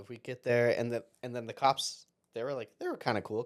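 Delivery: ordinary delivery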